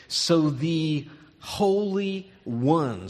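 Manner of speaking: "So the Holy One" said very slowly, leaning on "Holy"; deliberate slow stress on "Holy"; emphasis on Holy One. Shown as a demonstration. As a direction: stretch out "So the Holy One" so it sticks. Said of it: "circle those two words".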